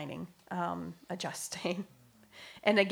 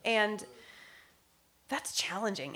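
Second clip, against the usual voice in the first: usual speaking voice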